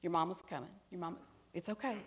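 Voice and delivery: put-on soft, soothing reassurance to a hurt child; comforting stranger. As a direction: hush her gently while holding her. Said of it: "just stroked my back".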